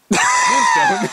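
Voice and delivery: very high squealed shout of a name; high showy shout. Acted. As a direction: call him in a falsetto yell. Calling friend's name.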